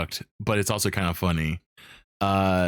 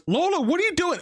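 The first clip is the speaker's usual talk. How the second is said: much higher, startled accusing question; shocked Maxine. Retold or imitated. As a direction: catch her red-handed. Maxine catches Lola with body.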